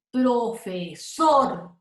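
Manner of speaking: "profesor" in slow separate syllables, SOR strongest; stressed last syllable. Shown as a demonstration. Teacher pronouncing examples for students, stress on SOR in "profesor". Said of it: "se pronuncian con más intensidad".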